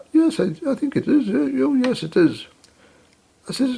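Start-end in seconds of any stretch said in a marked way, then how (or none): 0.1-2.5 lightly voiced flustered shop assistant, higher; a hint of a nervous young assistant, pitched higher. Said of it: "She says".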